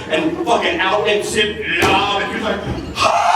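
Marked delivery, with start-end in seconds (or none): 3.0-3.3 loud imitated yell; mock scream. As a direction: cry out in comic shock. Imitated cry as bag comes off.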